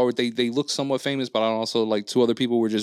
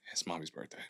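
much softer and lower, almost under the breath; soft reminder; 6 semitones lower, 17 dB quieter than usual. Retold or imitated. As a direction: murmur it like a gentle reminder. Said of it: "But he was like".

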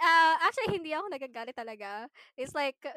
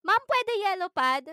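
loud mock student pleading; mimicking students; 5 semitones higher, 8 dB louder than usual. Teacher recalling students' requests, loud mock whine. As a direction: whine it like a pestering kid.

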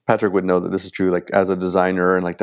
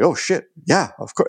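eager yes, pitched up high; retold excitement; 7 semitones higher than usual. Retelling saying yes to Rick and Rick's job, excited. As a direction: act out jumping at a job offer. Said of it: "I'm, like, excited to get work".

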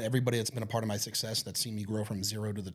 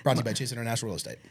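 very fast ad read; 3 semitones lower, much faster than usual. Acted. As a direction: rattle off the sponsor line fast. Sponsor plug read.

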